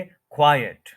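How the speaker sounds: "क्वाइट" as KWAI-et, syllables joined; no gap. Shown as a demonstration. Teacher showing it without a gap, joined two-syllable pronunciation. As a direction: join kwai and et smoothly in "क्वाइट". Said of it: "without gap बोलेंगे".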